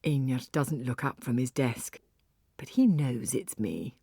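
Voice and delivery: ordinary talk, nothing marked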